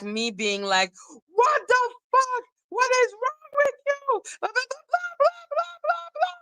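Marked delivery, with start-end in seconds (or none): 1.3-6.3 very high-pitched imitation of a shrieking rant; mock screaming. Acting out the outburst everyone expected from her, mock screaming. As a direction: squeal it out like a hammed-up screaming fit. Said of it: "is scream at you".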